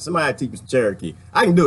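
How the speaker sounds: normal talking voice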